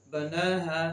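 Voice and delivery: long held ना in बना है; stretched vowel. Shown as a demonstration. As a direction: draw out the ना of बना है. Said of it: "खींच कर पढ़ते हैं". (बना है का ना खींच कर)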